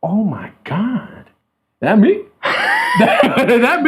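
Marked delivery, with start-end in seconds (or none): 1.8-3.5 surprised shout. Real, not acted. Friend shouts on seeing himself on screen, stunned. 3.5-3.9 giggling, can't believe it's you; laughing disbelief. Real, not acted. Friend laughs seeing himself, amused disbelief.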